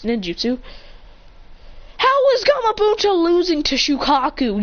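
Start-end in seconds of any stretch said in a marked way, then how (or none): 2.0-4.6 high, incredulous protest; baffled, high-pitched challenge. Real, not acted. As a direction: question it in raised-pitch disbelief. Speaker protests a plot inconsistency.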